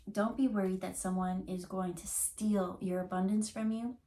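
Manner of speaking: normal talking voice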